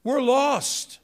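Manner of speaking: a hint of a lost driver's dismay; mock panic. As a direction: play up the panic of being lost. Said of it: "I thought".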